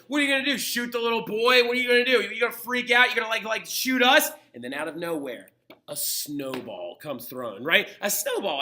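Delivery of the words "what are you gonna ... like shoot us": higher, louder crowd taunts; a teacher acting out a jeering crowd, raised and mocking; mock taunting yell. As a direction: goad the soldiers like an angry crowd. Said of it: "yelling at the British soldiers, being like".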